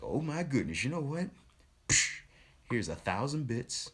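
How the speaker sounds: high, eager rush of generosity; excited gushing. Retold or imitated. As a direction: burst out like you can't wait to give. Acting out eager gifting of bits.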